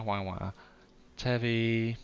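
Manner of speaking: ordinary talk, nothing marked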